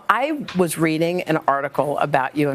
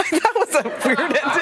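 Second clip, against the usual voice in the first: laughing, much higher; 10 semitones higher than usual. Real, not acted. Host closing the awkward interview, laughing. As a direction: laugh it off, high and bright.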